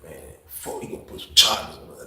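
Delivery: very low, under-the-breath worry; hushed grumbling. Retold or imitated. Inmate fears charges with roommate, hushed grumbling. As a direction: grumble it to your roommate, barely audible. Retold muttered talk with roommate.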